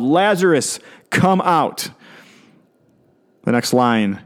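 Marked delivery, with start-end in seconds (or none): 0.0-1.9 higher-pitched, restrained calling out; mock yell. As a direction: call him out in a mild imitated yell. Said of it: "He yelled".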